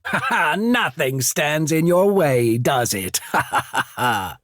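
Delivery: delighted laugh, opening high and settling down; amused laughter. Acted. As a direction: laugh heartily around the words. Amused praise of a bold ally.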